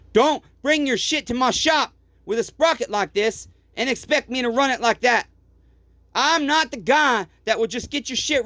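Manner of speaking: heated real rant at customers; angry rant. Real, not acted. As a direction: let it rise into real anger.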